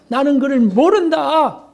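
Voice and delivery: slow, cursing denial in another voice. Retold or imitated. Peter cursing as he denies Jesus a third time, angry. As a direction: spit out the denial with a curse. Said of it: "하며 저주하면서".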